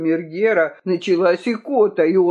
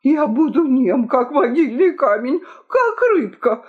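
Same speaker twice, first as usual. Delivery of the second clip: high, pleading assurance; anxious promise; 6 semitones higher than usual. A narrator's reading. Key-keeper threatened by the king, high anxious promise. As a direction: promise in a squeaky rush of submission.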